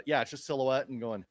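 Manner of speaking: usual speaking voice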